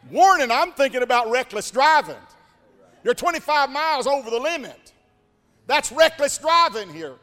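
high, loud, building lecture from a stern trooper; rising scolding shout. Acted. Highway patrolman shouting at the speeder, loud and high. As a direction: pile on the guilt like an angry cop.

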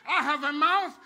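loud preaching rising to very high screamed praise; full-force preaching. Real, not acted. Preacher refuses to be outpraised, building to ecstatic screams. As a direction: build from a shout to shrieking whoops.

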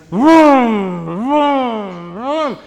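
loud high vroom of passing semi-trucks. Retold or imitated. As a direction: belt out each truck roaring past. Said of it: "semi-trucks coming behind me".

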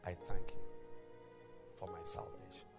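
guided prayer. Acted. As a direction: lead the prayer gently, line by line. Leads the sinner's prayer.

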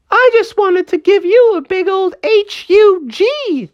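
very high cartoon squeal; pleading villain toy. Cobra confessing to Froggy why he chased him, high squealing plea. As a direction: squeal out the confession, spelling it emphatically.

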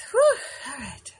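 sleepy, yawning through words. Real, not acted. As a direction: talk through a big yawn. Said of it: "I'm so yawning tonight".

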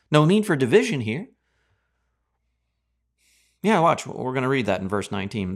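normal talking voice

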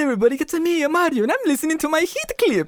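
very high-pitched cartoon hero voice; squeaky, sing-out mascot greeting; Mario. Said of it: "and then we were like".

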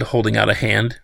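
plain reading voice

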